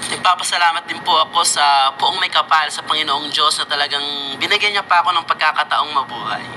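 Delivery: ordinary talk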